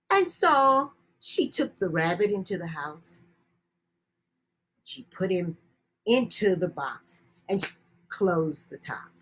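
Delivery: ordinary delivery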